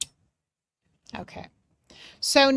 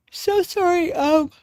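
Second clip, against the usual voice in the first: higher, yawned-out apology; yawning apology; 8 semitones higher, 4 dB louder than usual. Real, not acted. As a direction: apologize through a yawn. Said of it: "I'm yawning when I'm in her energy".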